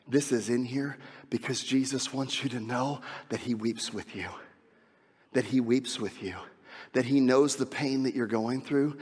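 low, softened pastoral voice; tender preaching. Real, not acted. Pastor comforts those facing death, hushed and tender. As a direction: drop low and soft to comfort the grieving.

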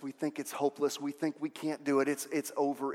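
ordinary talk, nothing marked

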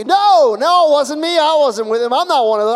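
loud, high, panicked-sounding protest; acted outcry of denial; heated denial. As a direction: burst out denying it over the accusers. Acting Peter's heated denial.

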